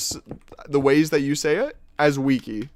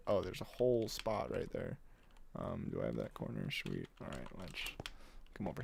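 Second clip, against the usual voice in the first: muttered very low to herself; mumbling; 8 semitones lower, 16 dB quieter than usual. Real, not acted. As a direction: mumble under your breath. Mumbling to self while building.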